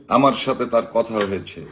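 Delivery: usual speaking voice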